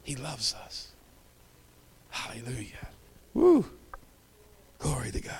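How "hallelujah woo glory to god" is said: whisper. Real, not acted. Whispered praise with a whoop.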